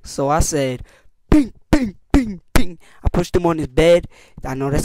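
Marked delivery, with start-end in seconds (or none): 1.3-2.8 punch sound effects. Retold or imitated. Punching the kid.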